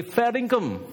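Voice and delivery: a light Aussie touch on "Fair dinkum"; Aussie saying. Retold or imitated. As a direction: toss off "Fair dinkum" with a hint of an Australian accent. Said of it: "Then they'll say".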